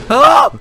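high loud scream of fright; startled scream. Real, not acted. Jump-scared by the monster, screaming loud and high. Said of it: "何今の声。".

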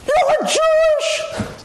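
high, very slow, stretched out southern drawl. Retold or imitated.

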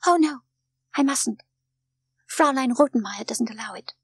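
put-on high little-girl protest; young Heidi, anxious. A narrator's reading. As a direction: protest as an obedient, worried child. Heidi says she may not cry.

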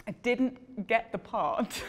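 slow, laughing punchline. Real, not acted. Telling the interviewer the outcome, slow and laughing. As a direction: drag out the punchline while laughing.

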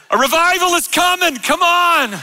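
high, hammed-up preacher's cry; mock revivalist shout. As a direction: call out a revival in playful earnest. Mock revival cry, then backs off.

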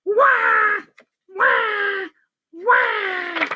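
high, sharp mock wails; yelling baby. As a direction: bawl like a scared baby. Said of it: "yelled as loud as it could".

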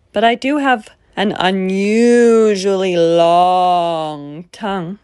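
slow, drawn-out monster voice; slobbery monster voice. Acted. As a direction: stretch it out like a drooling beast.

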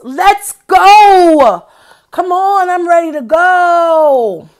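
put-on high, loud eager child pulling to leave. Teacher reading a toddler's tug, eager and loud. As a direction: tug them along, keen to go. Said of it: "A tug means".

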